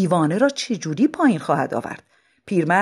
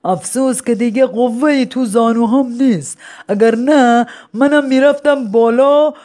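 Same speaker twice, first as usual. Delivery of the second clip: put-on rueful old man; 5 semitones higher, 5 dB louder than usual. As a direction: sigh over lost strength. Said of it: "آهی کشید و گفت".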